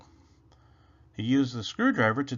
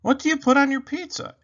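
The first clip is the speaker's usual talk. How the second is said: high, quick, louder bunny question; Rabbit voice; 13 semitones higher, 8 dB louder, faster than usual. Acted. Rabbit asking Robot about pizza toppings, high fast bunny voice. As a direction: rattle it off like an excited rabbit. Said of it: "asked Rabbit".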